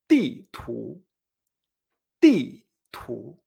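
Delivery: low "ti" dropping sharply, "tu" rising; dry fall, then rise. Shown as a demonstration. Teacher modelling map, "ti" falling and "tu" rising. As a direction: knock "ti" down, then lift "tu". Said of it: "baja con un golpe seco".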